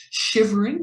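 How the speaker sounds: plain reading voice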